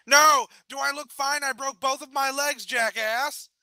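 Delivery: high, loud outburst on one breath; furious run-on shout. A narrator's reading. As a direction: shout it all as if one word. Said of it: "shouted an entire sentence as if it was a single word".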